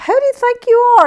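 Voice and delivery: high, quick indignant thought; retold indignation. Shopper silently answering the rude bread guy, mock indignant.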